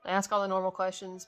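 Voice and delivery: usual speaking voice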